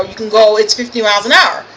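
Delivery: ordinary talk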